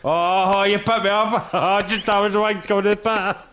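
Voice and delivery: ordinary talk, nothing marked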